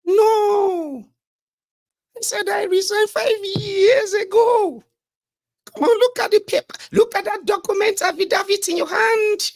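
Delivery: mock lying minister. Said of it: "how you talk when you are lying".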